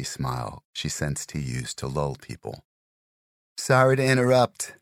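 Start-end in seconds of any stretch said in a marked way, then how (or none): 3.6-4.7 high, loud call over gym noise; raised voice. Acted. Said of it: "raising her voice over the sounds".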